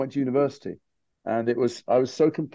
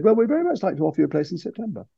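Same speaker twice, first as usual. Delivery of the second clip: higher-pitched impression of a well-spoken voice coach; 7 semitones higher, faster than usual. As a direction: imitate a voice coach offering a place, pitched up. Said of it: "she sounded like [name]".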